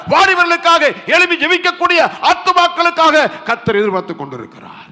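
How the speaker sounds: high, driving sermon cadence; impassioned preaching. Real, not acted. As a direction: pile up the appeals with rising urgency. Preaches at full force. (ஆவேசமான பிரசங்கக் குரல்)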